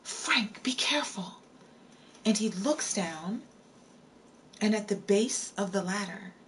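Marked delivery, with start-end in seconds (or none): 0.0-1.4 high, breathy warning whispered from below; eerie whispered warning from the dark; ghostly whisper. Acted. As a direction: whisper his name like an unseen ghost. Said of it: "he hears".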